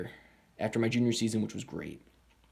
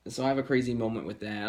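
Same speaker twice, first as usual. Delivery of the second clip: ordinary delivery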